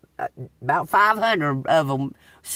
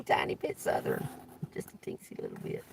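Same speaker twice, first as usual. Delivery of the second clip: joking whisper; 14 dB quieter than usual. Real, not acted. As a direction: sink into a tiny, barely voiced whisper. Jokingly plays down own Southern accent.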